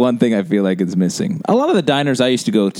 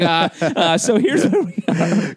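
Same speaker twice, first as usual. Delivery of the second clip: high-pitched, hesitant mock of a co-host; imitating Pete; 7 semitones higher than usual. Co-host mocking his friend steering off a tangent, imitated high. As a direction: do a high, stammering attempt to restore order. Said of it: "when Pete tries to write the shit".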